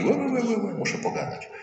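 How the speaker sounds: high, babbling gossip imitation; mock gossip chatter. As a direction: squeal it like a gossiping neighbour. (wysoka, przedrzeźniana paplanina plotkary)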